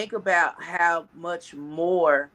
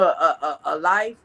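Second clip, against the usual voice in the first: ordinary talk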